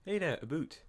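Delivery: high, breezy Canadian accent on "a boot". Shown as a demonstration. Asked for his best Canadian accent, light Canadian accent. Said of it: "Do your best Canadian accent".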